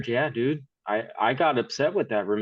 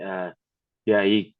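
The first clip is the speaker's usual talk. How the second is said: ordinary talk, nothing marked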